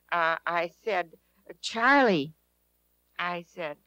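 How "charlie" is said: calling his name out loud. Retold or imitated. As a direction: call out to wake him. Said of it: "in a, quite a loud voice".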